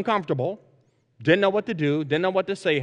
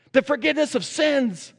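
sudden high, elated cry of discovery; joyful exclamation; 9 semitones higher than usual. Acted. Luther exclaims to the young confessor, elated and much higher. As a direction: burst out with the words like a revelation. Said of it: "Luther exclaimed".

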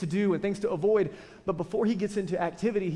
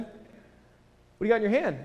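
probing dad; 4 semitones higher, slower than usual. Retold or imitated. Dad questions son.

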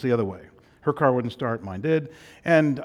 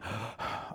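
sharp gasp for air; quick, winded gasp in; breathless gasp; 3 semitones lower, 12 dB quieter than usual. Real, not acted. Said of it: "out of breath".

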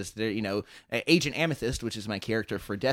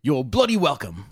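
gruff Cockney inspector's reply, louder; mock Cockney accent; 6 dB louder than usual. Game master answering thanks in character, loud Cockney accent. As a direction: punch it out in a British accent.

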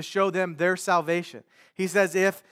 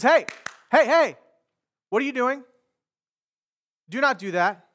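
loud, higher-pitched annoyed rebuke; acted annoyance, sharp raised "hey"; indignant calling out; 5 semitones higher, 5 dB louder than usual. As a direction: call out to stop them, indignant. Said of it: "He was probably angry and annoyed".

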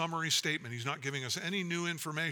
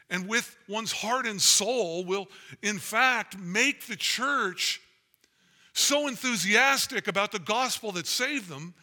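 louder, climbing, fervent sermon delivery; full-force preaching; 5 semitones higher, 5 dB louder than usual. Acted. Pastor urging the church that gospel opens wallets, full-force preaching. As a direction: preach it out with rising fire.

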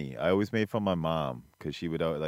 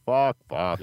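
much louder retold swearing; loud cursing; 3 semitones higher, 10 dB louder than usual. Landing back in Newark, loud mock cursing. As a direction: blurt it out loud, in mock dismay.

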